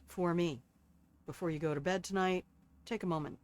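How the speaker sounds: ordinary delivery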